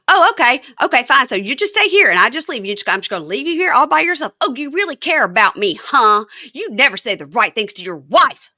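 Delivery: high, loud wounded sarcasm; mock angry tirade. Wife threatening to leave husband behind, mock furious. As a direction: imitate a wife blowing up at her husband.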